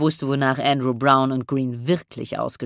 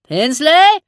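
high, loud call up to the rigging; raised, rising hail; 13 semitones higher, 9 dB louder than usual. A narrator's reading. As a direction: shout the name up to someone aloft. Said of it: "rief Andrew und blickte hinauf".

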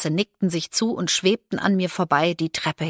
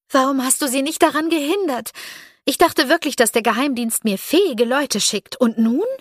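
angry; 8 semitones higher, 4 dB louder than usual. A narrator's reading. Scolding the agent for failing.

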